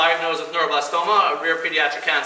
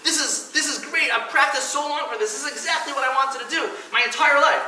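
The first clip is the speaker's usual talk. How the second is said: elated athlete; 7 semitones higher than usual. Pretend. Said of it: "he's gonna say".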